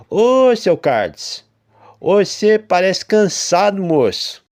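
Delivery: high, loud, slow country farmer voice; folksy farmer, loud and drawn out; old farmer. A narrator's reading. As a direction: greet him like a friendly old farmer. Farmer character voice.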